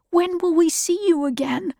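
put-on high-pitched boy's question; child's voice. A narrator's reading. Jonas asks Father when they will meet, high child's voice.

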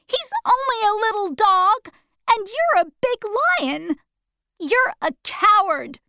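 put-on high girl voice, scolding; Dorothy scolding. Dorothy scolding the big lion, high and indignant. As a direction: tell him off in a young girl's voice. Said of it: "Dorothy scolding the lion".

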